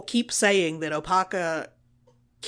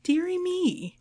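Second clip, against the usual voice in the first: prissy dearie me; 10 semitones higher, slower than usual. Retold or imitated. Mock prissy villain exclamation.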